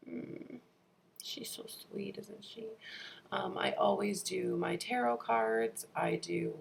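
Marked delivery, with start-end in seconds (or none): 0.1-2.7 tender whisper. Real, not acted. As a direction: coo it quietly to a little one. Cuddling the baby on camera.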